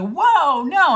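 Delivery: startled high loud whoa no; surprised. Retold or imitated. Reacting to a friend calling herself awful, startled high protest. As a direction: jolt up in alarmed protest.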